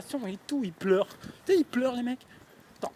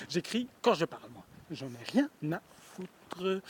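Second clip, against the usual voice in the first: ordinary talk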